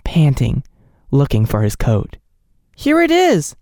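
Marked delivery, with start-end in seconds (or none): 2.7-3.5 high, eager cry; cried out. A narrator's reading. Nellie crying out to the doctor, high and eager. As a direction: hand it over eagerly. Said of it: "cried Nellie".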